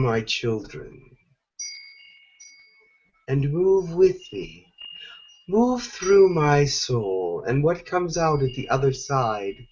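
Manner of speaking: Mother Gaia. Acted. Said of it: "And she is here now to guide you".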